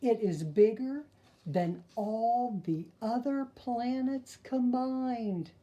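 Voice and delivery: put-on lively, sing-song read-aloud for kids; storyteller voice for little listeners; reading to children. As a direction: read the rhymes like a bedtime picture book. Reads picture book to children.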